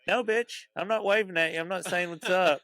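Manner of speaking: high-pitched indignant retort; exasperated protest. Retold or imitated. Struggling swimmer to lifeguard who just waves back, exasperated protest.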